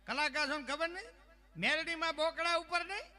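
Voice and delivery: high-pitched insistent questioning; indignant believer. Retold or imitated. Believer quizzes artist about goddess's mount, high indignant voice. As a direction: pitch it high, as if it were obvious.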